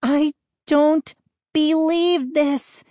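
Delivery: high, slow outraged wail; incredulous cry. A narrator's reading. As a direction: cry it out high, drawing out each word. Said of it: "Mindy cried".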